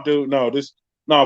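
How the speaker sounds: ordinary delivery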